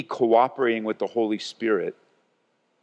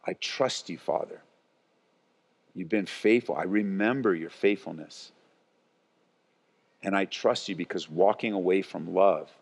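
quiet trusting prayer; 8 semitones lower, 4 dB quieter than usual. Retold or imitated. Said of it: "prayer to Father".

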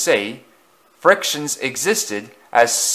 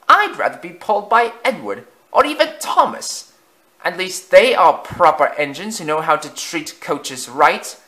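much higher, far-off fussy voice; prim old coach; 6 semitones higher than usual. A narrator's reading. Lead coach sniffing at Afton, prim. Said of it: "the brake coach added".

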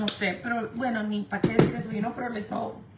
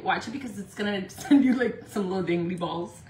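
ordinary talk, nothing marked

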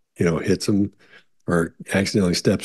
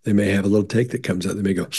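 ordinary talk

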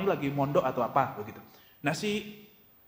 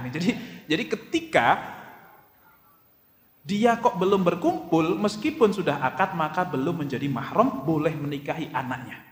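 usual speaking voice

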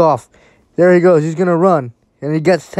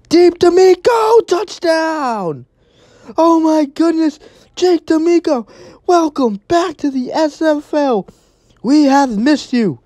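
thrilled call; 9 semitones higher than usual. Real, not acted. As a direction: burst out, then beam at the welcome back. Touchdown to returning receiver.

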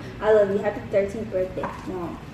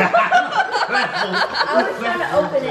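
laughing; 7 semitones higher, much slower than usual. Real, not acted. Laughing while struggling with the bag.

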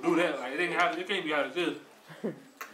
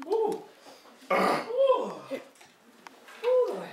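pained high ooh; much slower than usual. Real, not acted. Reacting to hot chip pain.

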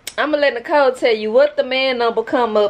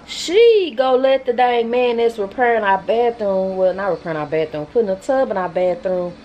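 ordinary talk, nothing marked

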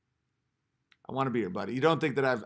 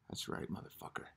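whisper; 19 dB quieter than usual. Real, not acted. Muttering to himself.

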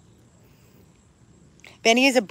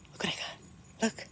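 teasing whisper; 3 semitones higher, 15 dB quieter than usual. Real, not acted. Teasing dog with shoe.

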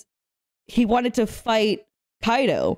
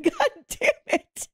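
laughing; 12 semitones higher, slower than usual. Real, not acted. Laughing at mom's protein habit.

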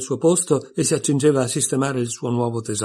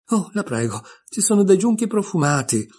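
burst of joy; 7 semitones higher than usual. A narrator's reading. Alice spots the scented rushes, sudden burst of joy. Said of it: "in un'improvvisa esplosione di gioia".

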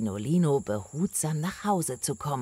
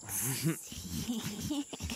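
snake giggle; 10 dB quieter than usual. Acted. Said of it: "Kichernd und zischend".

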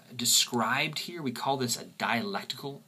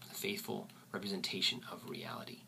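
soft whispered repeat; 3 semitones lower, 12 dB quieter than usual. Shown as a demonstration. Teacher repeats the definition in a soft whisper. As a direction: whisper it again, much softer. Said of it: "is the faithful representation of reality".

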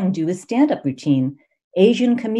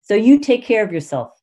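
defiant call, higher and louder; yell back; 5 semitones higher, 6 dB louder than usual. Retold or imitated. Answering a hateful caller, defiant higher louder yell. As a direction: stand your ground and throw it back at them. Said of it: "I would yell back".

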